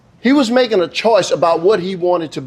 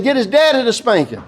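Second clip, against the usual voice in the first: high-pitched, emphatic comeback; acted exasperated urging; insistent suggestion; 7 semitones higher than usual. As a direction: argue it back with raised pitch. Officer argues with mother.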